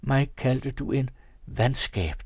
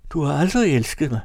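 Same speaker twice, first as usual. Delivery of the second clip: loud, higher-pitched outcry; shouted accusation; 3 semitones higher, 6 dB louder than usual. A narrator's reading. Fanny tears her arm free and accuses her husband, shouting. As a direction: cry out the reproach. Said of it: "råbte hun".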